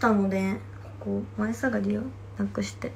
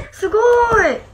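high, loud amazed squeal; real delight at the name; excited; 13 semitones higher, 11 dB louder, slower than usual. Real, not acted. Reading viewer name surprise. (高く大きな驚きの声)

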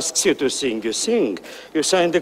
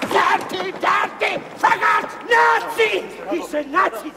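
much higher, shouted threats; furious rant; 13 semitones higher than usual. Acted. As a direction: lose control and yell the threats. Rant repeated, fading in level.

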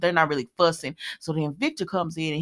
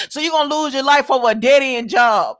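raised, louder retold challenge; mock scolding; 5 semitones higher, 5 dB louder than usual. Mother to Christina about her job, mock scolding. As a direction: scold her in imitation, pitched up. Retelling Alexis confronting Christina.

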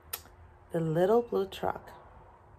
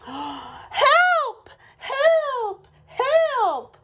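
very high, loud squeal; crying for help; 6 dB louder, much slower than usual. A narrator's reading. Little blue truck stuck tight, high panicked cries. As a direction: wail it out as a tiny truck. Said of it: "cried the little blue truck".

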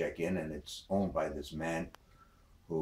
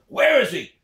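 high, loud demand; father hunting the traveler; angry father; 16 dB louder than usual. Acted. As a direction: bark out the question. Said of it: "She says".

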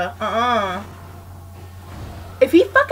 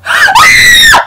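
high shrieking gasp, half laugh; screaming gasp; 13 dB louder than usual. Real, not acted. Caught by the killer, shrieking gasp. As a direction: shriek as he grabs you.

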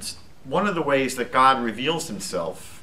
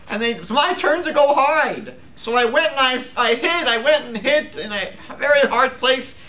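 put-on high, louder little boy's voice; upset young boy; 10 semitones higher, 3 dB louder than usual. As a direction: tell it like a hurt child. Crying boy voiced in story.

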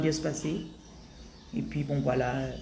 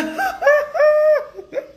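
loud, high shrieking laugh; real uncontrolled cackle; 8 dB louder than usual. Real, not acted. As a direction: burst out in helpless high laughter. Cracks up at her own impression.

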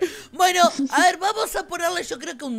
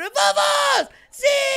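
victory cheer; 13 semitones higher, 6 dB louder, much slower than usual. Real, not acted. Player celebrates winning the vote, cheering loudly. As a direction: shout it high and loud.

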